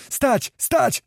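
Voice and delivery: high, sharp cries to halt; yelling. A narrator's reading. Other voices trying to stop the mutiny, high yells. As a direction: yell the order high, twice. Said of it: "wrzeszczały inne głosy".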